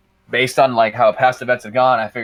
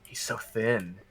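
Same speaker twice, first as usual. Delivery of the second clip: a much softer aside to nobody; muttered to himself; 11 dB quieter, much slower than usual. Retold or imitated. Friend reacts to Tony Stark in the theater, muttered quietly to himself. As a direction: say it quietly under your breath, as if to yourself. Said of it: "Like, to himself".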